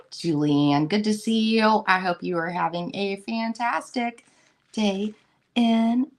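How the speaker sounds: ordinary talk, nothing marked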